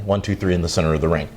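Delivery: ordinary talk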